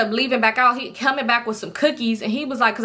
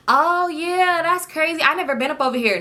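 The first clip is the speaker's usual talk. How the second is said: mocking surprised; 6 semitones higher than usual. Mocking Toxic's fake surprise.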